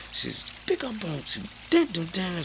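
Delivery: high chopped vocal sample imitation; mimicked sample. Showing the sample he put in, high mimic. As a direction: chop the words like a record.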